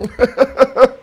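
high, loud burst of laughter. Real, not acted. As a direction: crack up after yelling. Laughing after his yell.

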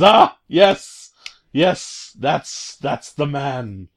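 delighted Hitler. Retold or imitated. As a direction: savor it, gloating. Hitler approving the player.

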